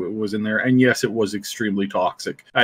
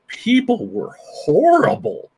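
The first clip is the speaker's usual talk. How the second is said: disgusted; 10 semitones higher, slower than usual. Real, not acted. Recalling toxic players.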